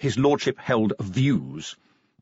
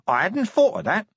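nasal corporal voice; 10 semitones higher than usual. Acted. Nobby voiced in read story.

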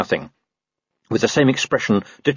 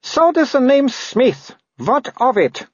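high, slow, disdainful noble lady; haughty Baroness; slower than usual. A narrator's reading. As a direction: look down on him from a great height. Narrator voices the Baroness.

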